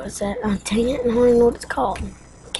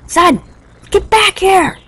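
high, loud call after son; dad shouting; 11 semitones higher, 8 dB louder than usual. Acted. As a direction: yell it as an alarmed dad. Dad calls son back.

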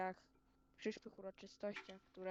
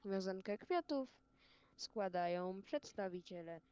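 ceremonial announcement. Acted. Announces wreath laying ceremonially.

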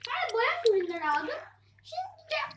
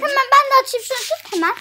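excited; 4 semitones higher, 12 dB louder, faster than usual. Real, not acted. Eager grabbing the candy.

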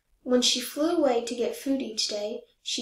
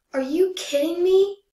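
loud, higher disbelief; exasperated out loud; 7 semitones higher, 7 dB louder than usual. Acted. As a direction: cry it out, fed up with the geese. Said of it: "I said out loud".

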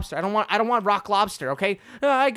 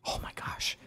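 barely-breathed oh my gosh, much softer; whispered awe; 13 dB quieter than usual. Real, not acted. Gamer alone in the dark deep sea, whispering in awe. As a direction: hush it like you found treasure in the dark.

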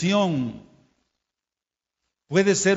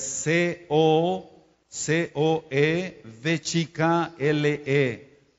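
slow, deliberate letter-by-letter spelling; preacher spelling a Hebrew word for learners, slowly; much slower than usual. Shown as a demonstration. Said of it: "decir cómo se pronuncia en la fonética".

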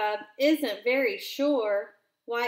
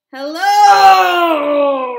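huge shouted hello, higher; loud, higher lion-cub roar; 6 semitones higher, 17 dB louder than usual. Acted. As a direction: roar a greeting.

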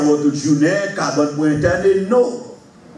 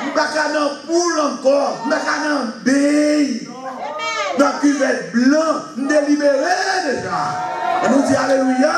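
full-force preaching; 8 semitones higher, faster than usual. Acted. Preacher crying out to the church, shouting and high. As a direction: shout it out to the congregation.

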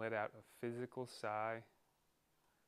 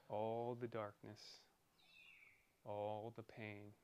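ordinary delivery

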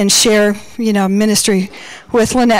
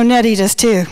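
ordinary talk

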